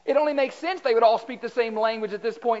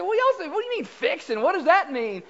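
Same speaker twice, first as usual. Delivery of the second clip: wife's puzzled question; 7 semitones higher than usual. Retold or imitated. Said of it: "She said".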